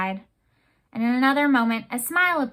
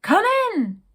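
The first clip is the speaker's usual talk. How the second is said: high, loud bellowed welcome; captain booming for visitors to enter; roaring call; 10 semitones higher, 6 dB louder than usual. Acted. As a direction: shout it over a gale. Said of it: "in a voice like the roar of a canyon".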